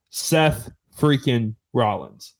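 slow announcer build-up; slow hype intro. Acted. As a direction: drag out the name to hype him. Hyping a guest's entrance.

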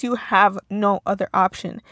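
ordinary talk

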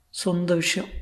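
soft, high, guarded voice; Sulekha. Acted. Crying actress avoiding her tutor's question, soft and high. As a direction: say it high and softly. Sulekha holds back private trouble.

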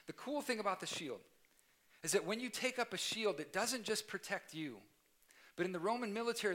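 building preacher's call. Acted. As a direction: lift the room toward the close. Preaching raised again.

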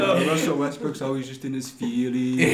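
lightly put-on impression of a Cameroonian player; Joel Embiid. Player jabs at Russell Westbrook, lightly imitated voice. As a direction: tease in a mock athlete voice. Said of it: "Give us a little Joel".

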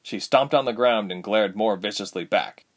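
plain reading voice

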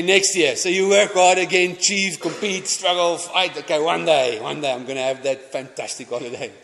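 preaching at full force. Acted. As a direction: push it harder line by line, like a pulpit build.

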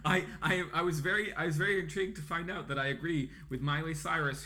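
laughing. Real, not acted. Laughing while telling.

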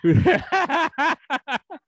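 high, loud cackling laugh; hearty laugh. Real, not acted. Preacher joking with the congregation, hearty laugh. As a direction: burst out laughing, long and high. Amused by daughters and sons remark.

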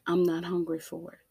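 ordinary talk

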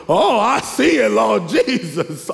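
loud high "Oh, I see it", then a slow drawn-out "Lord Jesus"; excited cry. Retold or imitated. Shopper spots temptation in the store, loud excited cry. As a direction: spot the treat and cry out in delight. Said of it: "you look".

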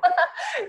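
real high laugh bursting out; short real laugh, pitched high; high burst of laughter. Real, not acted. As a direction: laugh at the memory. Laughing at her own story.

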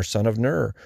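ordinary delivery

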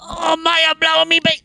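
mock Cockney climax cry; Cockney climax yell. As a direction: bellow it like the drunk flatmate. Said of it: "old English Cockney dude".

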